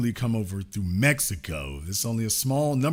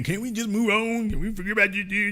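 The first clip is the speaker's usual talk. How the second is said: mocking retold quote; 5 semitones higher than usual. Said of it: "the Kamala Harris folk are now saying".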